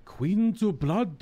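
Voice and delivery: a hint of a Japanese accent, slow and drawn out on Queen's Blood; slowly, mock Japanese accent, words stretched. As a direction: lightly imitate a Japanese speaker saying Queen's Blood. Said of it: "say it in English with a Japanese accent".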